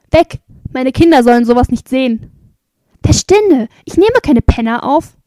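loud, indignant rejection; angry shout. A narrator's reading. Resident sending a barefoot stranger away, angry shout. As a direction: shout to drive someone away.

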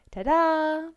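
bursting out high and loud; proud tada. A narrator's reading. Mara proudly shows her sandwich to her friend, excited tada. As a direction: show off the prize with a proud flourish.

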